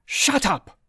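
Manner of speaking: high, loud angry snap; snapped. Acted. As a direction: cut him off sharply. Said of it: "Betty snapped".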